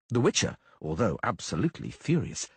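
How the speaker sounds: usual speaking voice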